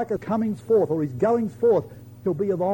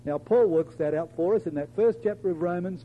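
usual speaking voice

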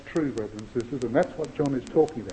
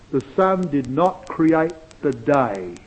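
ordinary talk, nothing marked

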